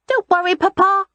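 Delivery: put-on high, assured child voice; small boy reassuring his father; confident little boy. A narrator's reading. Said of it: "Rye said confidently".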